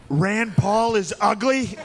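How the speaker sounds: high, drawn-out debate insult; Donald Trump. Retold or imitated. Candidate insulting a rival on the debate stage, high slow impression. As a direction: pitch it up and stretch each word. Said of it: "it finally got to Trump".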